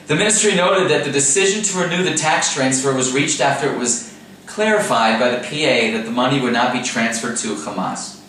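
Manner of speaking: normal talking voice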